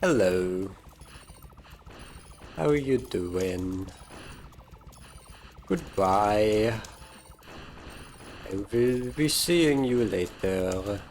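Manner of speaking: put-on greeting and farewell with a faint French accent; slight French accent. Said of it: "accent turned slightly French".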